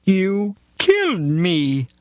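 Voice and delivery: high, slow, accusing cat voice; a dying cat accusing the driver. Retold or imitated. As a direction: drag out killed in a high wounded cat voice. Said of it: "the cat said".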